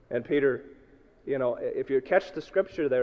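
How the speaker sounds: ordinary talk, nothing marked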